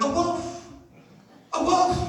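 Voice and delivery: high, yapping dog saying wolf twice; barking dog. Retold or imitated. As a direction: bark it out like a small dog. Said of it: "it went".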